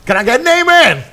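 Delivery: high, loud preacher call. Pretend. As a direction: rouse the congregation. Preacher calling congregation.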